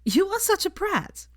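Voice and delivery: put-on high, fond teasing girl's voice; fond Hermione. A narrator's reading. Hermione fondly teases Draco, high and affectionate. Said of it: "said Hermione fondly".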